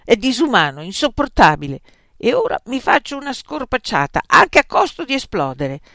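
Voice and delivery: loud, fed-up declaration; worked-up, defiant complaint; exasperated outburst. A narrator's reading. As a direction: vent it with mild theatrical outrage. Outraged rant about food.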